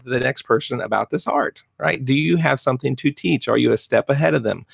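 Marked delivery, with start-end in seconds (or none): none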